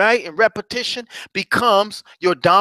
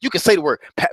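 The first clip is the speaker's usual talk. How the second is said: usual speaking voice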